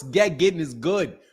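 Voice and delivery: ordinary delivery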